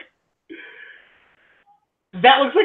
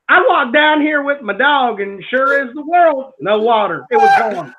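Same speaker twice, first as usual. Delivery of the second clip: put-on Tennessee accent; 6 semitones higher than usual. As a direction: tell it like a Tennessee country local. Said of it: "let me read it in the accent".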